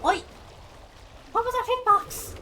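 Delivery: shrill outraged yell; raging gamer. Real, not acted. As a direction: yell at the unfair hitbox. Angry at unfair hit.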